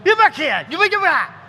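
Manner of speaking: shouted high, panicked; a cornered bird man yelling at wolves; frantic bird man. Acted. Aarakocra shouting at the circling wolves, panicked yell. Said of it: "and he's going".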